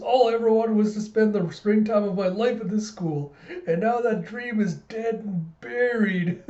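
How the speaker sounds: high, melodramatic wail breaking into laughter; hammed-up tearful lament, dragging out the end; mock bawling. George mourns his school days, mock bawling. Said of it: "starts just bawling his eyes out".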